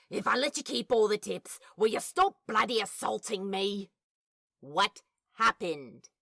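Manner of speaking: ordinary delivery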